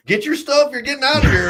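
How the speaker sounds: high, loud, thrilled yelp; excited cellmate. Retold or imitated. Cellmate jumping off his bed cheering him out, high excited shout. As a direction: burst out like a cellmate who can't believe it. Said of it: "he was like".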